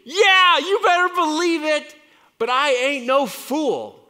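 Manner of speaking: loud high proclamation; defiant shout. Real, not acted. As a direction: belt it out proudly. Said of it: "shouting back defiantly".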